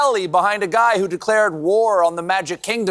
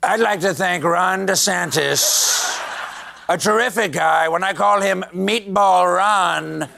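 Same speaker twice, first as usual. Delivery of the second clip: Trump-style speech. Retold or imitated. Imitating Trump right after his clip.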